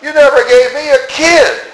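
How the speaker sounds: elder brother complaining to his father, high and aggrieved; resentful complaint. Acted. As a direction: whine it like a cheated son. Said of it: "He said".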